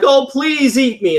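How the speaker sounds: high, slow mock pleading; sly Brer Rabbit. Brer Rabbit tricking the fox and bear, slow sly plea. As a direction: beg sweetly, tongue in cheek. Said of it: "he's like".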